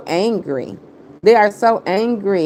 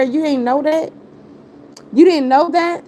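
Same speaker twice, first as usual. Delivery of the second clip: taunting; 6 semitones higher than usual. Real, not acted. Taunting the rival live.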